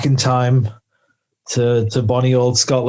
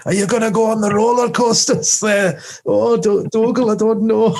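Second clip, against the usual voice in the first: put-on higher-pitched Scottish accent slipping into Welsh; badly faked Scottish lilt, pitched up; botched Scottish accent; 9 semitones higher than usual. Highlanders off to a theme park, mock Scottish gone Welsh. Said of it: "What the fuck accent was that?".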